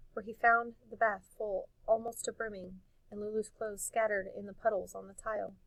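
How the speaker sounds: ordinary delivery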